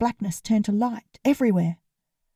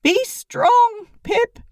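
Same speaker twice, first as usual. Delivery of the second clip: raised, higher and louder for the room; loud farewell; 13 semitones higher, 5 dB louder, much slower than usual. A narrator's reading. Lennon saying goodbye to Pip for the room, loud and high. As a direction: call it out so everyone hears. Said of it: "Then loudly, so everyone in the room could hear".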